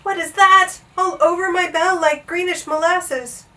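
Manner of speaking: high, startled dismay; gulping alarm. A narrator's reading. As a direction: cry out at an unwelcome sight. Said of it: "he gulped".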